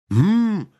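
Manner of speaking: high, loud bleat of a ram; ram's head bleating back at him; bleating hum. Acted. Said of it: "проблеял баран".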